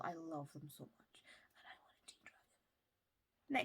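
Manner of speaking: slow, soft whisper; whispered longing. Real, not acted. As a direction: confide it in a hush. Gushing aside about the books.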